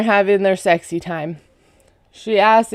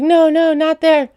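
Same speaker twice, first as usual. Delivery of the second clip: high, louder startled objection; mock alarmed protest; 8 semitones higher, 4 dB louder than usual. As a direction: imitate someone jumping at a sore touch. Retold jump at painful touch.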